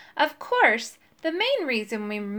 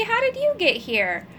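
higher, delighted greeting; happy; 10 semitones higher than usual. A narrator's reading. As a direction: introduce an old friend with joy. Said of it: "he cried happily".

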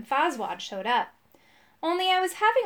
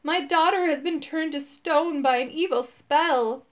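distressed, higher-pitched plea; acted sobbing; 4 semitones higher, 3 dB louder than usual. A narrator's reading. Weeping man explains the evil spell, acted distress. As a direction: play a weeping parent, voice lifted. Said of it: "sobbed the man".

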